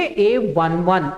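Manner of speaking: ए वन वन slowly, each digit वन apart; one one, not eleven. Shown as a demonstration. Teacher models the right way to read the element ए वन वन. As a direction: spell out ए वन वन digit by digit. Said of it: "इलेवन नहीं पढ़ेंगे".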